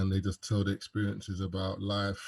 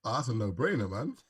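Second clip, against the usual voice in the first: normal talking voice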